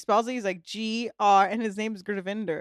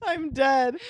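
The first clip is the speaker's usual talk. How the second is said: ordinary delivery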